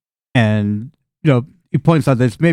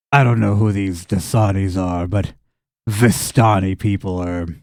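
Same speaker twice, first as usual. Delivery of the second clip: low-key contempt from a country farmer; a hint of a weary, scornful farmer; simple farmer, disdainful; 4 semitones lower than usual.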